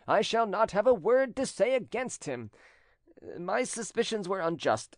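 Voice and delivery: high-pitched, earnest apology; apologetic suitor, smoothly contrite. A narrator's reading. Defeated cousin apologising to the bride-to-be, smoothly contrite. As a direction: profess devotion with elaborate courtesy.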